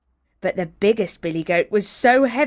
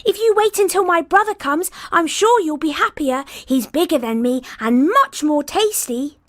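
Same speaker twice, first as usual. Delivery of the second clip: much higher, louder tiny goat voice; small voice; 8 semitones higher, 5 dB louder than usual. Acted. Littlest goat telling the troll where he goes, tiny and high.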